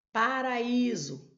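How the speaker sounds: sounding out slowly. Shown as a demonstration. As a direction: drag Paraíso out for the class.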